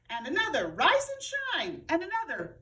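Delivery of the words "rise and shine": bossy wake-up call. A narrator's reading. Camilla yells at leaves.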